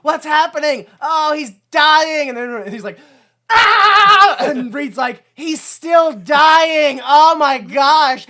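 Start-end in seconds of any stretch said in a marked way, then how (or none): 0.0-2.3 loud, high, drawn-out alarm; mock panic. Onlooker watching Victor die, mock panicked cry. 3.5-4.4 very loud, high, sustained scream; mock agonized scream. Victor still being electrocuted, over-the-top scream. 5.3-8.3 very loud, high, slow wail; mock horror. Reed watching Victor die, overacted horrified wail.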